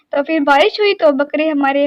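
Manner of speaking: normal talking voice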